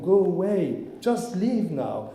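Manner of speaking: wishing he could order Netanyahu away, mock-stern; imagined command. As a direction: tell him to leave, drawn out. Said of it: "to say to Netanyahu".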